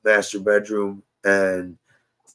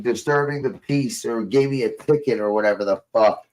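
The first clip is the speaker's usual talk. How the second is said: ordinary talk, nothing marked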